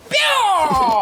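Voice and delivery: high, shouted 'bia'; long cartoon attack yell; kick cry. Running soccer kick to end it all, high shouted cry.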